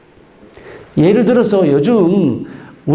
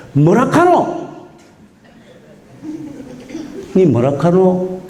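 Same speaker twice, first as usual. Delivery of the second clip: a higher, incredulous Gyeongsang 뭐라카노 that slows on 니 뭐라카노; Gyeongsang dialect; 3 semitones higher, slower than usual. Retold or imitated. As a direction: ask 뭐라카노 in disbelief, Gyeongsang style. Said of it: "믿기 어렵다고 말할 때 뭐라 그런지".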